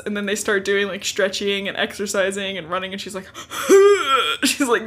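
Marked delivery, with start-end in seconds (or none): 3.3-4.4 loud high gasp for air; loud exhausted gasp. Retold or imitated. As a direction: gasp like winded from running. Exhausted from running drills.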